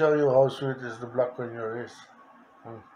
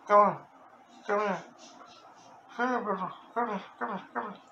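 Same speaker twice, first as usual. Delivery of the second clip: higher, sweet calling to a pet bird; coaxing the bird; 7 semitones higher, faster than usual. Real, not acted.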